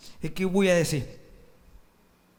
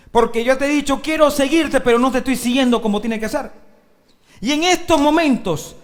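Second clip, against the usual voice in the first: loud, raised, urgent prayer; fervent confession; 5 semitones higher, 6 dB louder than usual. Acted. Preacher showing believers how to confess to God, fervent. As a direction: plead forcefully with God.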